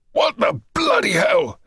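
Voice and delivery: high-pitched outraged shout; storming-in bellow; furious roar. A narrator's reading. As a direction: roar in rage at the scene. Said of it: "he roared".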